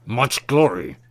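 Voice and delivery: words mashed by heavy teeth; mashed through teeth. A narrator's reading. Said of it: "mashing the words between his heavy teeth".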